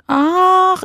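normal talking voice

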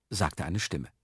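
ordinary delivery

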